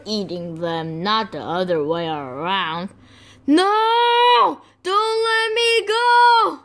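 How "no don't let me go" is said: high, loud desperate wail; clingy baby chick. Acted. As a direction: beg not to be dropped.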